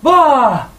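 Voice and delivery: loud exaggerated gym exhale; dramatic sigh. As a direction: heave it out like dropping weights. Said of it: "make a big sigh".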